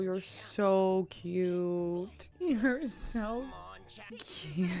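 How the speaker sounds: soft, very drawn-out adoring coo; gushing coo. Real, not acted. Viewer gushing over Pikachu, soft cooing. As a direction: melt over it, softly and slowly.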